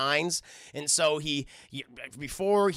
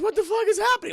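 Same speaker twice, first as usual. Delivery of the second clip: much higher, louder confused outburst; mock bewildered cry; 6 dB louder than usual. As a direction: sound startled and lost. Woman waking confused after exorcism.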